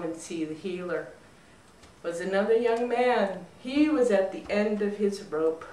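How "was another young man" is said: ordinary delivery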